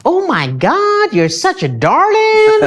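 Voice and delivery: high, gushing mock old lady voice; mocking a sweet old American lady, very high and sing-song. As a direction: ham up a doting American granny cooing over a boy. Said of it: "they're like".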